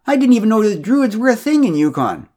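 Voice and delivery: high, loud, astonished; indignant declaration. A narrator's reading. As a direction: announce it like shocking news. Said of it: "she declared".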